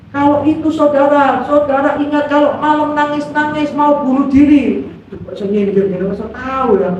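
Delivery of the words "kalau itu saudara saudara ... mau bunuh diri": imitating a preacher with raised pitch; pendeta. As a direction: address the congregation as an insistent preacher. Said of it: "pendeta yang ngomong lagi". (menirukan pendeta dengan nada tinggi)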